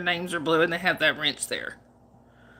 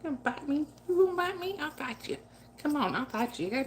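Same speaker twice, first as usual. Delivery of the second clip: playful mock threat; 7 semitones higher, 6 dB quieter, faster than usual. Playfully taunting her biting cat.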